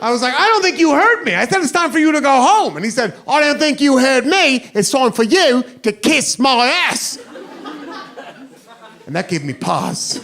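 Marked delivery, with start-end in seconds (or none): 0.4-2.8 high-pitched, insistent American comeback; acting out a worked-up warning. Repeats his warning to the drunk. 3.3-7.2 high, defiant drunk British mimicry; mock British pub-brawler comeback; British accent. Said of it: "cussed at in that accent".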